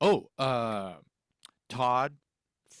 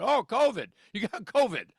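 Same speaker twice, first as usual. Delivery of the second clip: mock panic; 11 semitones higher, 5 dB louder than usual. Mocking people scared of coughs.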